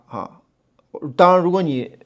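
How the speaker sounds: ordinary talk, nothing marked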